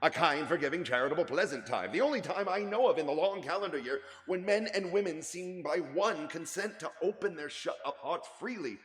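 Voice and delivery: high, loud earnest laughing plea; heartfelt speech. Acted. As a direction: praise Christmas with warm conviction. Nephew's warm Christmas speech.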